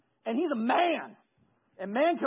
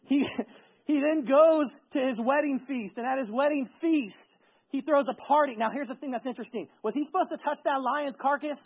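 ordinary talk

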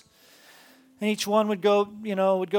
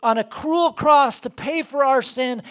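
louder and higher, building sermon fervor; preaching at full force; 5 semitones higher, 6 dB louder than usual. Acted. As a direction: preach it with rising conviction. Preaching climax about God.

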